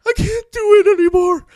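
put-on high, whiny little kid voice; whiny scared kid. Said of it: "and the kid goes".

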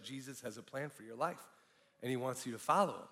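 soft, low, matter-of-fact retelling; stranger's first delivery. Retold or imitated. Stranger stopping a man leaving the nightclub, soft and low-key. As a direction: voice the stranger gently and quietly. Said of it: "he said it a little more sheepishly".

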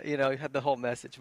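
normal talking voice

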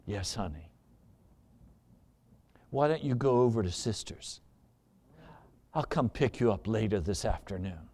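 low, very soft, placating reply; meek husband. Acted. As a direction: answer her meekly, voice dropped right down. Meek husband answers furious wife.

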